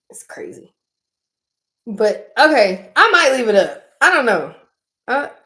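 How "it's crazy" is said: breathy whispered aside. Real, not acted. Telling viewers the family is crazy, whispered. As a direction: whisper it under your breath.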